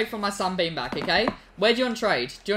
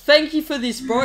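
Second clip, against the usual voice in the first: ordinary delivery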